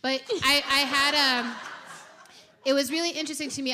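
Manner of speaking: high and loud, rushing; excited laughing. Real, not acted. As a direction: laugh and rush the words.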